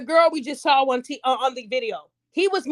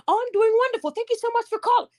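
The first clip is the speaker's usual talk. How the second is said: high, sweetly professional phone manner; polite job-call voice; 6 semitones higher than usual. Acted. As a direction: put on your best voice for an employer's call. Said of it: "when we get those calls for a job".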